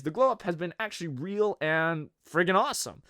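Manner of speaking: ordinary delivery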